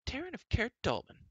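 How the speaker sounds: very high, scolding full-name address; princess declaring. A narrator's reading. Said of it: "Elenwy declared".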